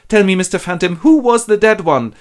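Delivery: high, loud imploring question; anxious pleading. Acted. Scrooge asks the phantom who died, pleading.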